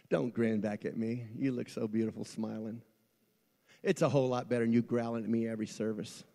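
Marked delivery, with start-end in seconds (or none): none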